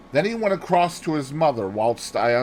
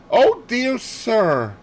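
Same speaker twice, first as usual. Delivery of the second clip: high, slow, anguished plea; distressed cry; 10 semitones higher, 6 dB louder, much slower than usual. Acted. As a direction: wail it out as she wrings her hands. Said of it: "cried Dorcas, wringing her hands".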